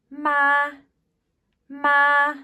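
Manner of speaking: "ma" held high and level, loud; first tone ma. Shown as a demonstration. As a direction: sing "ma" on one high flat pitch. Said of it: "what these might sound like".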